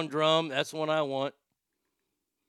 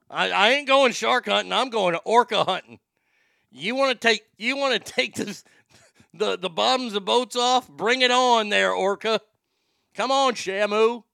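loud, higher, hyped-up taunting; 6 semitones higher, 7 dB louder than usual. Pretend. Taunting imaginary orcas while hunting them, loud and hyped. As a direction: pump yourself up and dare the orca.

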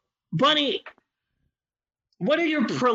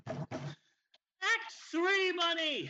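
act three announcer; 13 semitones higher, 10 dB quieter, much slower than usual. Shown as a demonstration. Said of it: "I was about to do Bunny like it was Act One".

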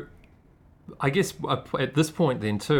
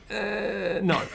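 dismissive; 10 semitones higher than usual. Retold or imitated. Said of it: "I'd be like".

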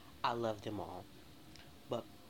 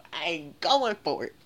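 laughing through the words, much louder and slow; 3 semitones higher, 10 dB louder, slower than usual. Real, not acted. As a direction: burst out laughing while insisting. Laughing at own outrage.

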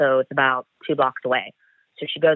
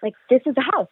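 high-pitched excited gasp of discovery; 11 semitones higher than usual. Retold or imitated. Agent telling partner he found the suspect's house, thrilled. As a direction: burst out, pitch jumping way up with thrill. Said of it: "I'm getting really excited".

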